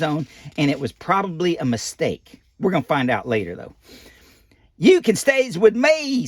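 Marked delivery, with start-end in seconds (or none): 4.8-6.3 higher, slow, louder kindly bird; lightly voiced Big Bird. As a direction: offer it like a big gentle bird. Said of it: "says Big Bird".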